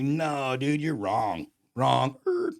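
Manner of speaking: mock-indignant refusal, loud and high; protesting. Acted argument with God.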